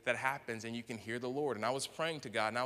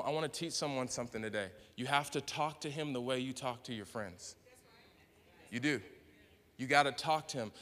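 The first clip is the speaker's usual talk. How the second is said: ordinary delivery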